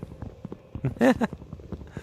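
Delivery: high breathy giggle. Real, not acted. Laughs at a joke.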